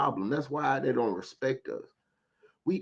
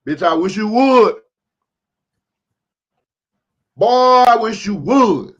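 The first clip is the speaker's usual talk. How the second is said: much higher and louder, slowing threat; real angry shouted dare at online threats; furious dare; 9 semitones higher, 16 dB louder, slower than usual. Real, not acted. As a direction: shout the challenge, daring them. Daring threat at tweet.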